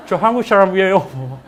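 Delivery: put-on slow, loud, laughing American-accented Korean; hammed-up foreigner speaking Korean; overdone foreign pronunciation. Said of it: "한국말 이런 식으로 하는 거죠".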